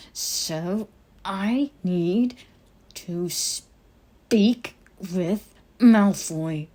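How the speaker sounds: slow, strained, through gritted teeth; clenched jaw. Acted. Harry insisting to the elf through clenched teeth. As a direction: grind every word out.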